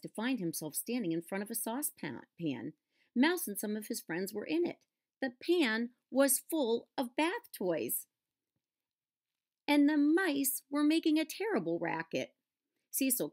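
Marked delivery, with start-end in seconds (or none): none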